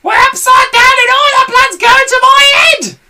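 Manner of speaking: very high, loud hag screech; Mrs Twit screaming. A narrator's reading. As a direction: screech in terror. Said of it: "screamed Missus Twit".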